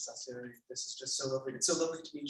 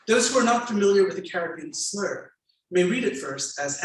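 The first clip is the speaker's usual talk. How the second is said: louder, measured reading aloud; memoir reading; 3 semitones higher, 7 dB louder than usual. A narrator's reading. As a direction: read the passage to the audience with weight. Author reads memoir aloud.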